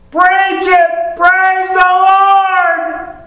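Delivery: high-pitched drawn-out holler of praise; excited church matriarch. Retold or imitated. Old church aunt moved by a blessing, mock ecstatic holler. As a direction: wail it out slowly, way up high, hammed-up. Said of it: "given to fits of excitement".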